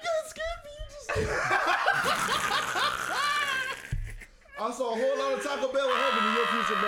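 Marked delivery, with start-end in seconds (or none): 1.1-4.5 loud laugh. Real, not acted. Wild laugh after scare.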